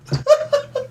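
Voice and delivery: a sudden squeaky laugh; high burst of laughter. Real, not acted. Reader cracks up at the fanfiction, high laugh.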